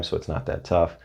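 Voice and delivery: ordinary delivery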